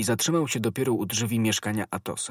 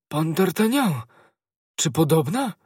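high, amazed exclamation; astonished recognition; 6 semitones higher, 4 dB louder than usual. A narrator's reading. Servant recognising the disguised young man, astonished. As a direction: exclaim in disbelief.

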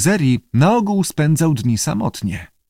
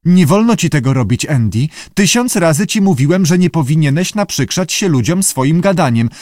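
raised, louder reprimand; exasperated keeper's lecture; scolding; 6 semitones higher, 4 dB louder than usual. A narrator's reading. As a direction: tell off a misbehaving pet.

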